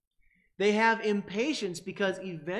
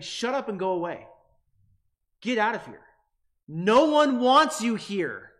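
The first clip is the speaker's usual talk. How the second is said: rising to an angry, much louder shout; a crowd yelling at a beggar to clear off; mean; 5 semitones higher, 10 dB louder than usual. Retold or imitated. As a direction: shoo him off, harsher with each phrase. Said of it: "to get out the emotional impact".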